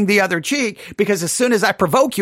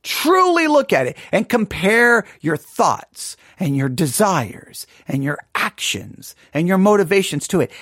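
normal talking voice

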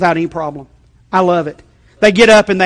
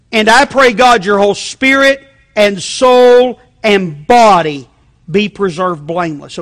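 slow, solemn read of the verse; scripture reading; 3 semitones higher, 6 dB louder, slower than usual. Acted. Preacher reading the verse to the church, slow and solemn. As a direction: lift the verse out slowly and loud.

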